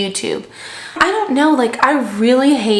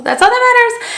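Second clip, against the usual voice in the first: much higher, playful declaration; high-pitched mock-triumphant wrap-up; comic high voice; 14 semitones higher, 6 dB louder than usual. Real, not acted. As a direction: pipe it up high after a hush. Comic triumphant wrap-up after whisper.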